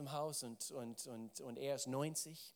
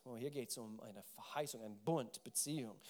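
usual speaking voice

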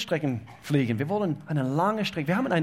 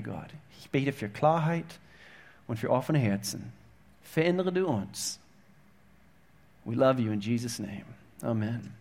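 leise, andächtig gebetet; 3 semitones lower, 5 dB quieter than usual. Real, not acted. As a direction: ruhig und innig beten. Leads a prayer. (leises Gebet vor der Gemeinde)

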